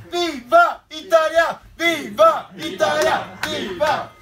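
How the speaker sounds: loud, high, slow Viva Italia; Italian fan shout. Acted. Italian football fan celebrating his team's win, shouting. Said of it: "Claudio, l'italien".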